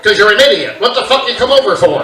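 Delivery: loud mock-angry dad; dad scolding. Seated dad loudly scolding his foolish kid. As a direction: bark at the kid from the chair.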